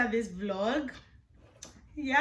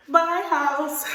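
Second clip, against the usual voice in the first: excited; 7 semitones higher, 6 dB louder than usual. Real, not acted. Excited farewell to empty house.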